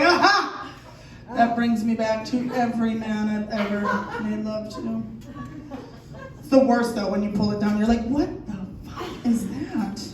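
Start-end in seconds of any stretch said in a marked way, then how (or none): 0.0-0.6 high, loud exclamation mimicking a woman; mimicked audience reaction. 8.1-10.0 slow, drawn-out stunned question; mock appalled disbelief. Acted shock at partner's anatomy.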